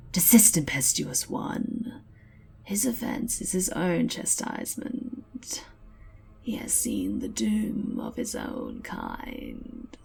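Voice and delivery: slow, hushed ancient voice; ancient slow whisper. Acted. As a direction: speak slowly like an ancient sage. Slan rebukes impetuous skink.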